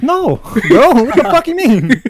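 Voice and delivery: high, loud mock outrage; retold protest, voice thrown up high; exasperated retort. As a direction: shout back in comic disbelief.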